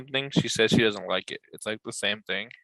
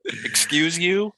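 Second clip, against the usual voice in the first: mock offended; 5 semitones higher, much slower than usual. Mock indignant toddler.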